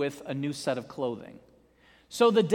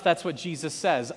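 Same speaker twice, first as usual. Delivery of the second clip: normal talking voice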